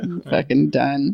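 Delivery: slow fed-up drawl; fed-up deadpan. Pretend. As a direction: say it like you're walking out. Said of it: "That's what she said".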